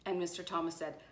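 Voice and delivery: usual speaking voice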